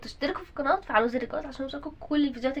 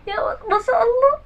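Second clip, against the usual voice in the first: mock tears of joy; 9 semitones higher, 6 dB louder than usual. Mother moved by son's grade.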